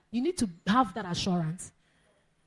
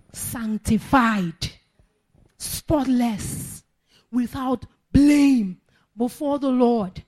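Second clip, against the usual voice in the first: slow, drawn-out proclamation building louder; slow preaching; 6 dB louder, much slower than usual. Acted. Pastor declares the believers spotless, slow and rising. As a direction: lay each word down slowly, building.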